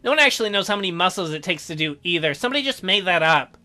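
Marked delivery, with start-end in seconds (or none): none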